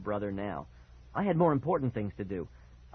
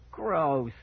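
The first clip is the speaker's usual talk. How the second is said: raised, drawn-out exclamation; gleeful cry; 4 semitones higher, 4 dB louder than usual. A narrator's reading. Brother delighted by spat-out food, crying out. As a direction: cry out in mock disgust. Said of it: "Mickey cried, grinning".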